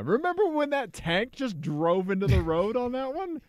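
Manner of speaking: high, loud, excited recall. Real, not acted. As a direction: blurt it out, thrilled. Excited recall of dash cams.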